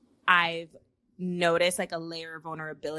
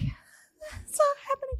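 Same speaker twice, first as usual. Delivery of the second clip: very high, drawn-out mock sob; retold crying. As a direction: squeak it out slowly as if crying. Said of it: "And I was like".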